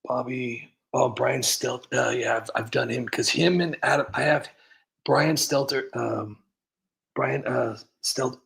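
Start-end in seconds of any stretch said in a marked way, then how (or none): none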